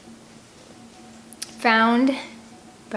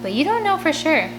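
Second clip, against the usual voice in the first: insistent; 8 semitones higher than usual. Acted. Kid arguing back at Beans.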